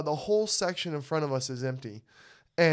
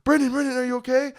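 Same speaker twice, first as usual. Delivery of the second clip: higher and louder, urgent calling; retold panic; 8 semitones higher, 4 dB louder, faster than usual. Lifting his collapsed friend, calling his name in panic. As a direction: re-enact shaking an unconscious friend.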